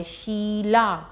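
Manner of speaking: शीला with the ई held very long; teacher modeling the long ई in शीला; very slow Sheela. Shown as a demonstration. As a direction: stretch शीला right out. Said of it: "जो ई है वो देर तक".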